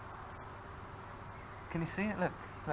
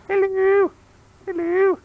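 loud high shouted hello; 10 dB louder, slower than usual. Real, not acted. Dad shouting hello at the camera for Grandma, loud and high. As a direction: call it out as loud as you can.

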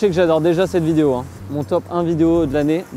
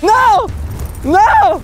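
high mock shout; 5 dB louder than usual. Mock panic at the radar.

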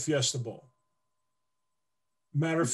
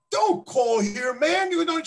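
much higher, louder mock brush-off; retold warning; 12 semitones higher, 4 dB louder, slower than usual. Player retelling brushing off the caller, high loud mock warning. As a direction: shoo off the prank caller. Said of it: "I said".

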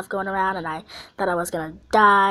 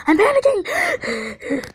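high, stretched panicked squeal; scared panic; 11 semitones higher, 4 dB louder, slower than usual. Real, not acted. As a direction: cry out in sudden panic, much higher. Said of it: "I'm scared".

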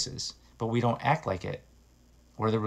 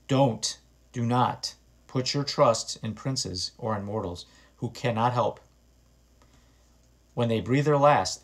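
scripture reading; 2 semitones higher, 4 dB louder than usual. Acted. Reads scripture aloud.

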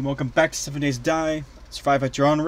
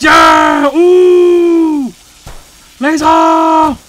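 very high, loud whooping; wild excited shouting; 13 semitones higher, 14 dB louder, much slower than usual. Real, not acted. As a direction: cheer at full volume, drawn out. Thrilled firing lasers in game.

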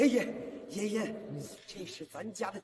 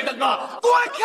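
shouting; 2 semitones higher, 10 dB louder than usual. Acted. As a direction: roar at her to get out. Brother shoves sister aside.

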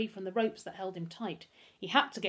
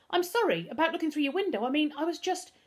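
put-on higher, louder, pleading boy's voice; flustered boy; 10 semitones higher, 6 dB louder than usual. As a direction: apologise hastily as a cornered boy. Frightened boy apologises to Pickering.

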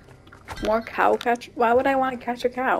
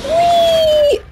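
high, loud, long whee; gleeful whee; 7 dB louder than usual. Real, not acted. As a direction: squeal with joy on the drop. Another jump.

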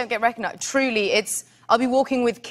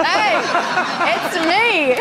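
higher, playfully calling out; mock indignant announcement; 6 semitones higher, 3 dB louder, much slower than usual. Actress retelling her reply to gossiping girls, mock indignant. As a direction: announce yourself with comic indignation.

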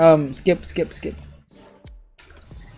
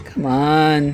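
usual speaking voice

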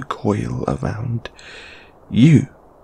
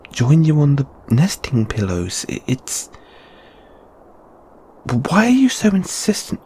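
high, stammering refusal; flustered protest; 3 semitones higher than usual. Acted. Refusing to join companion on pillows, flustered stammer. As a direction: stumble over the words, pitch jumping up in protest.

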